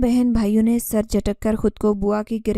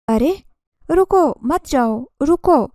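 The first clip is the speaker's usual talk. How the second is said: louder, higher, drawn-out call to stop; urgent calling out; 5 semitones higher, 6 dB louder than usual. A narrator's reading. Aunt calling the children back, urgent shout. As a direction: call after someone running away, alarmed.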